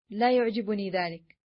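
ordinary delivery, nothing marked

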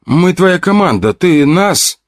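put-on girl's voice. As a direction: plead up high, eagerly. Girl pleading with him.

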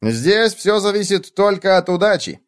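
high, grand pronouncement; pompous high bug voice; self-important bedbug. A narrator's reading. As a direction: explain it like a little expert, high. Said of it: "важно сообщил он".

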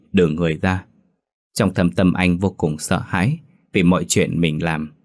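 ordinary delivery

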